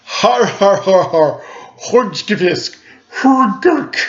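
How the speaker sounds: loud, high hammed-up villain cackle; big evil laugh. A narrator's reading. Norwegian dinosaur's evil laugh, loud and hammy. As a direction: cackle like a cartoon monster. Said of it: "a big, evil laugh".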